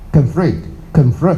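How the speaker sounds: conflict, conflict very slow, ending on a rise; American pronunciation. Shown as a demonstration. Teacher repeating the American conflict for students, slowly. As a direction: stretch conflict twice, slowly and carefully. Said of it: "他们念".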